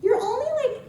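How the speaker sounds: high-pitched reveal; retold talent agent. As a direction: pitch it up as the agent delivering news. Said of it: "She goes".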